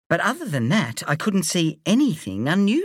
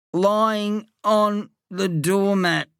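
very slow, higher, feeble voice; weak wheeze; 6 semitones higher, much slower than usual. A narrator's reading. Friend lying on the doormat answering, slow and wheezy. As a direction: drag it out as if too weak to talk. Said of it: "he gasped".

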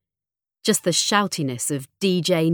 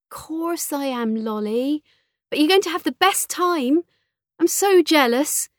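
affectionate mother; 10 semitones higher, 3 dB louder than usual. A narrator's reading. Mother telling daughter she'll be missed, high and affectionate. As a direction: gush encouragingly in a raised pitch.

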